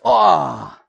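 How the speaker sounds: drawn-out mock "oh" of wonder; lightly hammed-up awe; imitating awed radio bloke. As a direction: play a radio commentator watching fireworks. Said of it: "the bloke on the radio goes".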